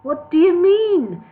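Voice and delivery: high, loud, slow peeved elf. Acted. Elf lord peeved at hobbit's question. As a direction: let irritation show. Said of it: "sounding for the first time peeved".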